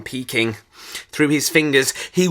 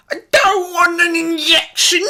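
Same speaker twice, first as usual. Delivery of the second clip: very high, screeching tantrum; screeched protest; 4 dB louder than usual. A narrator's reading. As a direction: screech the refusal. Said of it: "screeched horrid Henry".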